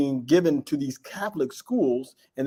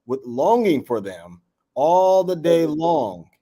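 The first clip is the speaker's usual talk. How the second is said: slow and louder, reading the verse aloud; slow, louder Bible verse reading; scripture reading; 5 dB louder, much slower than usual. Acted. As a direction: read the old words slowly and deliberately.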